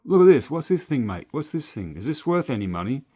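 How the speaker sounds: high, loud mock mate voice, asking eagerly; imitating clueless mate. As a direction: play the excited clueless workmate pushing a find at you. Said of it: "And he's always like".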